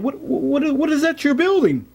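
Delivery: high-pitched clown asking a question. Acted. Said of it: "a clown walked past and said".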